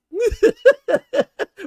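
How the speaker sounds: loud high-pitched cackle of disbelief; high shrieking laugh. Real, not acted. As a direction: let a loud squealing laugh burst out. Bursts out laughing at own joke.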